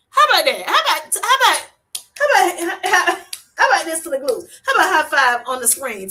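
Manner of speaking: high, loud cheering; delighted squealing praise; excited. Real, not acted. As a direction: hype up the glute gains. Celebrating viewer's glute gains.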